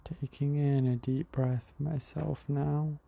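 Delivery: calm breathing guide talking the listener through; guided meditation. Acted. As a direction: ease the listener into the breath, unhurried.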